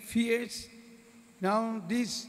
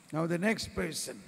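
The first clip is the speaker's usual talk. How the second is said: normal talking voice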